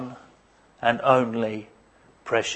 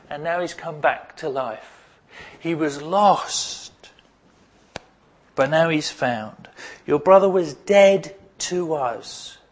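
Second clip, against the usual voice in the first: gentle father. Retold or imitated. Father to his older son, lightly voiced and tender. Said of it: "And he says this".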